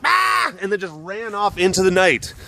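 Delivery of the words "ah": short high yelp; imitated animal cry. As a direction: mimic a startled pet's cry. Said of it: "And then she went".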